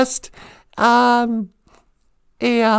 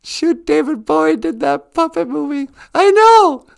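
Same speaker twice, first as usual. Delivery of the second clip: normal talking voice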